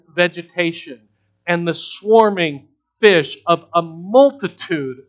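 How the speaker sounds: sermon swelling from firm to very loud, high force; building preaching. Real, not acted. Preacher recounting creation to congregation, swelling preaching. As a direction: build the creation list to a thundering peak.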